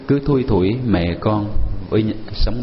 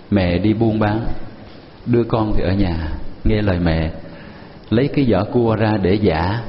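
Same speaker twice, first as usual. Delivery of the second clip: ordinary delivery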